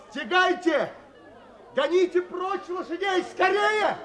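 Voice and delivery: high, frantic calls to the crowd; desperate cry to drive the horses off; urgent shouting. Acted. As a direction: shout them into action, fast. Narrator shouts at crowd in danger.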